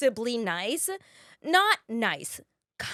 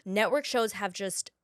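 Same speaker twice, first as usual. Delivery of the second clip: normal talking voice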